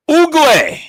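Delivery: high, loud jeer; shouted insult. Real, not acted. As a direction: belt it out high as a punchline.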